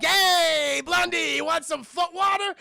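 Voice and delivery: high, loud jeering offer; a bandit's high mocking holler; taunting shout. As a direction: yell it out to mock him. Tuco taunting with foot water.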